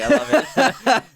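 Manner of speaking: high, loud laughing; real laughter. Real, not acted. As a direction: laugh the words out.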